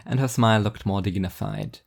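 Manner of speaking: plain reading voice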